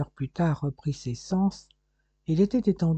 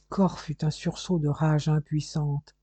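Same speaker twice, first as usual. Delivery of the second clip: ordinary delivery, nothing marked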